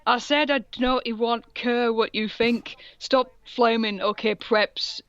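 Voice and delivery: ordinary talk, nothing marked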